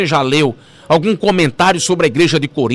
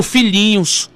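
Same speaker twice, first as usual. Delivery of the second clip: tender address; 2 semitones lower, much slower than usual. Acted. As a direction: savour the words like a loving father. Paul's tender address to church.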